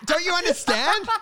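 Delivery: high, incredulous pleading with nan; exasperated grandson. Retold or imitated. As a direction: explain it like she's mad. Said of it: "I'm like".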